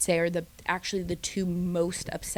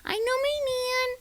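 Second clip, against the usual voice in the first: much higher, squealing giddy voice; giddy girls; 14 semitones higher than usual. Retold or imitated. Mocking the women squealing after the blindfolds come off. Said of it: "they're all like".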